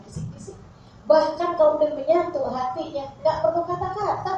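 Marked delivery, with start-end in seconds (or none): 0.1-0.5 very hushed demonstration whisper; barely audible, intimate murmur. Shown as a demonstration. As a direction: whisper it as lovers would. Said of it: "apa suaranya pelan".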